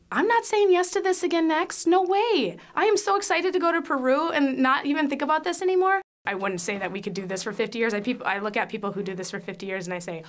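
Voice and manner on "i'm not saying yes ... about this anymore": high, loud mock protest; acted-out refusal. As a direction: protest emphatically in a raised, pitched-up voice. Acts out refusing to foster again.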